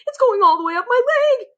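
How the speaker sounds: very high, loud frantic squeal; panicked cook. A narrator's reading. Cook feels the mouse climbing his leg, squealing. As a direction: squeal in horror.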